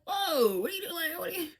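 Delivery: high, alarmed what are you doing; mock alarmed pushback; startled pushback. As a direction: back him off, half laughing. Rejecting his advance, retold.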